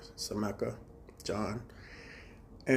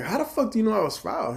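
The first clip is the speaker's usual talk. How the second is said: indignant question; 10 semitones higher than usual. Retold or imitated.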